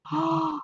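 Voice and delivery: high, soft intake of breath; surprised gasp. Real, not acted. Streamer thanking a viewer for a gift, surprised gasp.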